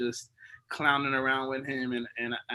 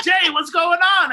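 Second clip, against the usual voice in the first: high, loud mock yell for help; 14 dB louder than usual. Yelling to Jay in the night, retold loud and high. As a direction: call out across the dark room. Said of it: "I'm, like, yelling out".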